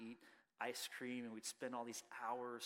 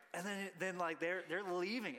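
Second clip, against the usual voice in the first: ordinary talk, nothing marked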